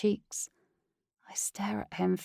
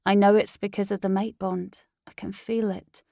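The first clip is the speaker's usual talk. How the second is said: plain reading voice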